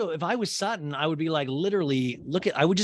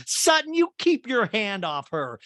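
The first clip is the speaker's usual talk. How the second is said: higher, louder, slow mock scold; imitating an angry friend pointing her finger; 9 semitones higher, 5 dB louder than usual. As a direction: scold her like a fierce aunt.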